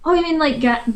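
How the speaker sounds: ordinary talk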